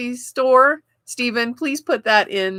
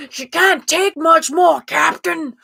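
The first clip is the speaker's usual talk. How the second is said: put-on high, loud Scotty-style Scottish accent; hammed-up bad Scottish accent, slow and pushed high; horrible Scottish accent; 8 semitones higher, 4 dB louder, slower than usual. Engineer warns the captain the shields are failing, bad Scottish accent.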